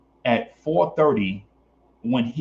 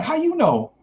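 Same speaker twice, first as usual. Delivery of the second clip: clueless woman; 12 semitones higher than usual. Retold or imitated. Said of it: "And she's like".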